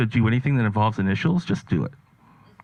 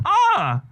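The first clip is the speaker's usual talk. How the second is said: sharp high yelp of fright; startled yelp; scream; 4 dB louder than usual. Retold or imitated. Comedian grabbed by an old lady in Walmart, startled high yelp. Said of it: "I screamed. She grabbed me and I went".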